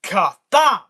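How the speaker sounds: a loud, high comic-book impact sound; shouted ka-thump. Acted. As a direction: bang it out like a comic panel.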